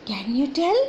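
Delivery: ordinary talk, nothing marked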